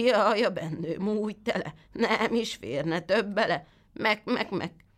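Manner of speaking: a sly goat bleating its rhyme, pitched high. Acted. The goat fibs to the boy in a bleating goat voice. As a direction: stretch the rhyme slowly and end on a bleat.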